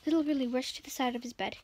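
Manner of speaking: ordinary delivery, nothing marked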